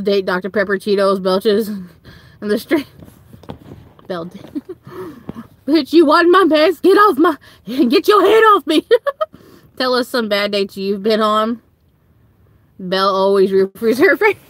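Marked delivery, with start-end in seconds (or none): 5.7-8.8 mock indignant yell. Shoving the sleeping date away.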